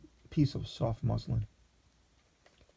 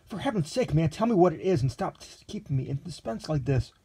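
a touch of impatience, higher voice; lightly voiced impatient young man; 6 semitones higher, 3 dB louder than usual. A narrator's reading. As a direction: press him, a little exasperated. Impatient demand.

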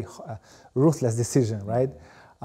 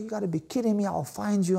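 higher, retold mock threat at the bank guy; hammed-up fury, pitched up high; mock Albanian rage; 8 semitones higher than usual. Retelling a call to the bank guy, mock furious threat. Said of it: "I went Albanian on the guy too".

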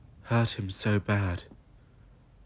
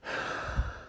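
heavy sigh; 4 dB quieter than usual. Acted. Alone after the tardis leaves.

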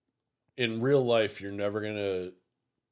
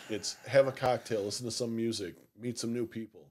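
ordinary delivery

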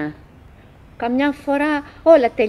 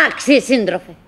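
normal talking voice

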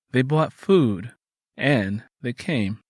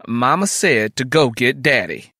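firm, louder order at an even pitch; louder, sterner; 6 dB louder than usual. A narrator's reading. Sister insists her brother fetch Daddy, louder and stern. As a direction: lay down Mama's word sternly. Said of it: "spoke louder and more sternly".

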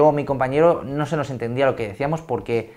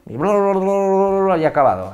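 rattling through; 6 semitones higher, 4 dB louder than usual. Shown as a demonstration. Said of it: "tiramos el turbo".

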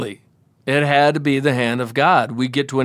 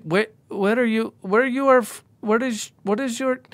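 put-on drunk questioning; 7 semitones higher, slower than usual. Drunk friend quizzing store owner.

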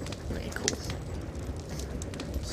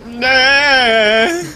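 high loud nah; 12 semitones higher, 9 dB louder than usual. Pretend. Character voice.

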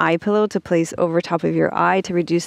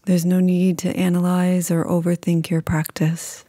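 calm, unhurried meditation voice with long pauses; guided meditation. Acted.

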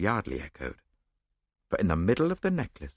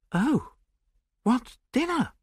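high-pitched, exasperated impatience; impatient; slower than usual. A narrator's reading. Friend dismissing talk of dinner, impatient. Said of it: "I said impatiently".

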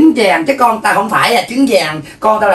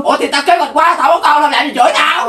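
higher-pitched, affronted protest; indignant retort; 6 semitones higher than usual. Acted. Neighbor defending herself for having no child, indignant. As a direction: push back as if wrongly insulted. Quarrel between two mothers.

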